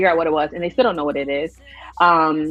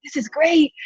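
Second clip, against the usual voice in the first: high, teasing parents' quip; mock teasing; 10 semitones higher than usual. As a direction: tease like a proud parent. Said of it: "my parents were, like, teasing me".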